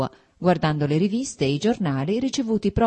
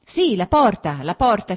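loud, urgent call about the door; shouting; 4 semitones higher, 6 dB louder than usual. A narrator's reading. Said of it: "gridò".

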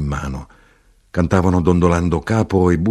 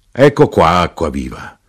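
loud calls, building higher; innkeeper's hawking cry; 4 semitones higher, 6 dB louder than usual. A narrator's reading. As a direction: cry up the drink, building to a cheer. Innkeeper keeps crying out the drink.

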